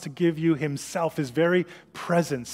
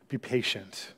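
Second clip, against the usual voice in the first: usual speaking voice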